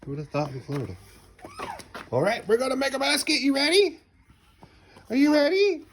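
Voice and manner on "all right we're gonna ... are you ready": higher and louder build-up; eager game-time voice; excited hype. Real, not acted. Hyping toddler for a basket.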